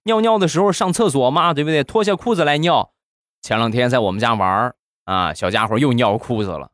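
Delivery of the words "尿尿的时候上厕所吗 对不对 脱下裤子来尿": high-pitched mock scolding; 训斥孩子. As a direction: nag like an exasperated parent, pitched up. Parent scolding a toddler, acted. (学家长高声数落孩子)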